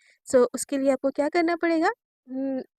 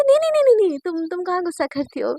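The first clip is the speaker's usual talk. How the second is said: flustered denial; 3 semitones higher, 4 dB louder, faster than usual. Acted. Naina hastily appeasing her angry partner, flustered denial. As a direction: backpedal in a mock panic. नैना घबराकर मुकरती.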